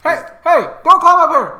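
high, loud warning shout; shouting from crow's nest. Acted. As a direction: yell down to stop him. Lookout warns climber.

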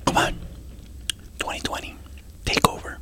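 hushed whisper. Pretend. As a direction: whisper it, barely voiced. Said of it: "Why are we whispering?".

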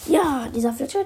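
usual speaking voice

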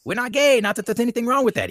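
high-pitched imitation of an adamant denial; adamant protest. Quoting George from Seinfeld denying it, adamant protest. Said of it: "he's very, very adamant".

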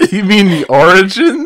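amused laughing disbelief. Real, not acted. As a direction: laugh while correcting her. Friend laughing at mispronunciation.

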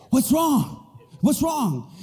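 high, urgent acted concern; alarmed parent. Parent to a crying child, acted alarm.

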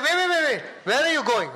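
mock-panicked shout to stop someone; urgent calling out. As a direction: call after him, high and loud, in comic alarm. Calls after man who is leaving.

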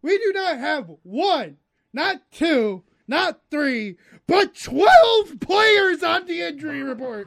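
slow build, twelve punched loud and high; stress on twelve. Shown as a demonstration. Host building suspense before twelve injured players. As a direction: count up slowly, then land hard on twelve. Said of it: "building to the big number".

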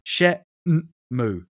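Shenmue drawn out slowly as "she-n-moo"; syllable-by-syllable pronunciation. Shown as a demonstration. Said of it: "you can tell from the katakana".

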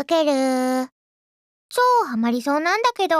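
quick, airy gyaru talk; gal slang voice. Acted. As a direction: slip into valley-girl gyaru mode. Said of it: "ギャル語が伝染してしまっていた".

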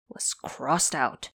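ordinary delivery, nothing marked

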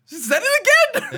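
very high, loud squeal of disbelief; incredulous outburst. Retold or imitated. Viewer reacting to the repeated odd word, incredulous. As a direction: shriek it, half laughing.

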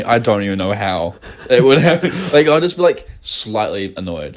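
ordinary delivery

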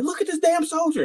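very high-pitched mock sergeant; high, exasperated sergeant impression; indignant first sergeant. Retold sergeant's indignant complaint.

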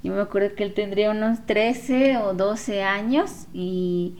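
normal talking voice

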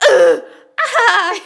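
high loud laughing shriek; mock scream. Imitating friend's laugh-scream in theater. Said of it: "you'd be like".

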